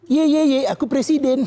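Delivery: playful mock boast; mock gloating. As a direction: rub it in like a kid teasing. Jokowi gloating on the phone.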